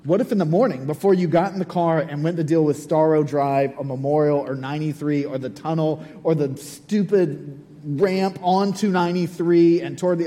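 ordinary delivery

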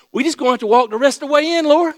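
loud, high, hurried call; acted calling out. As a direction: call it out fast to the Lord. Acting disciples calling to the Lord.

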